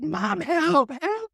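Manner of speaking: put-on high, loud little-kid plea; kid calling for help. Boy behind the bathroom door calling mom, high plea. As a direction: call out like a boy stuck in pain.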